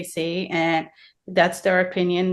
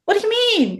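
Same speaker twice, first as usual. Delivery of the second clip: surprised protest; 3 dB louder than usual. Real, not acted.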